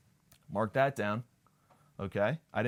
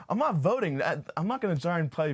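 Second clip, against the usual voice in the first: mock yell; 7 semitones higher, 4 dB louder than usual. Shouting at miming coworkers.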